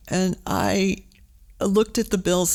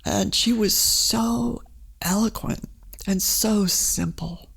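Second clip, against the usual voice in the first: moved, slow; 2 semitones higher, slower than usual. Real, not acted. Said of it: "And it still affects me today".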